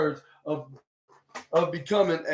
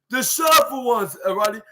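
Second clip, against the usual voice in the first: higher-pitched, exasperated imitation; Adam Cole, mock fed up; 7 semitones higher than usual. Adam telling off Roddy, lightly hammed-up exasperation. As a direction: imitate someone at the end of their patience, pitched up.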